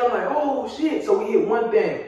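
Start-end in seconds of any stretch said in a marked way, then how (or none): 0.3-1.1 high, sudden alarmed gasp of words. Retold or imitated. Driver just before the crash, high alarmed. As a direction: blurt it as the crash comes.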